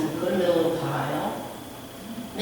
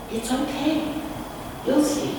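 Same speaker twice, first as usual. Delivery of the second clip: higher, soft, soothing reply; gently calm the frightened people; calm reassurance; 7 semitones higher, 4 dB quieter than usual. A narrator's reading. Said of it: "He said".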